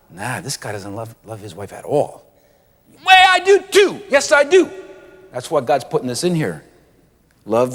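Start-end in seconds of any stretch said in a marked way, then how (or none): 0.1-2.2 low, much softer, offhand voice of a stranger; a scoffing bystander passing judgment, lower and quieter; dismissive low aside from an onlooker; gruff onlooker. Acted. Imagined onlooker judging the husband. 3.1-4.7 high, loud indignant protest; a husband insisting loudly and much higher that he does; squeaky outraged denial, pitched way up; defensive husband. Acted. Husband protests that he loves his wife.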